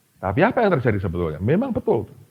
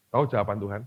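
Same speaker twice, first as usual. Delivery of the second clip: usual speaking voice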